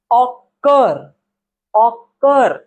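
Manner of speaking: ऑकर in two slow beats, ऑ... कर; split into syllables. Shown as a demonstration. Teacher proving the same condition, ऑकर split slowly as ऑ... कर. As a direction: draw ऑकर out, pausing between ऑ and कर. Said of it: "दो बार आपका जबड़ा नीचे आ रहा है".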